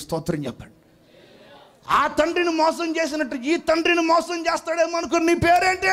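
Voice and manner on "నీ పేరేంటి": booming shout. Acted. God shouts question.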